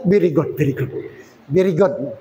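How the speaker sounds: low, understated ironic approval; mock praise. Preacher pretends to applaud a careless borrower, dryly mocking. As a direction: drop the pitch well down for dry mock praise.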